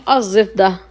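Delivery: अज़िफ्दा with the l dropped and the z doubled; correct assimilated form. Shown as a demonstration. Teacher giving students the right form अज़िफ्दा, l assimilated. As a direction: say अज़िफ्दा as one word with a doubled z. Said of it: "अल जिफ्दा नहीं".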